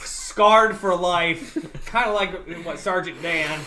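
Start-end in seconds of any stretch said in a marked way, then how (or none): none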